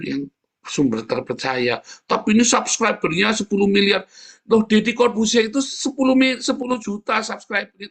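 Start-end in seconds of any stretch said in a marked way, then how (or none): 2.1-7.9 high, loud exasperated preaching; heated rant. Real, not acted. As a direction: rail at the crowd, voice up high. Heated rant about YouTube fools. (khotbah lantang dan jengkel)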